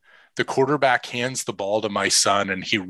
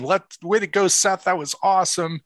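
much higher excited cheer, imitated; retold cheering, pitched up high; cheering teammates; 9 semitones higher than usual. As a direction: echo the team whooping for Seth. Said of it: "hooting and hollering and cheering".

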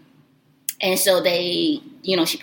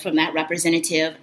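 usual speaking voice

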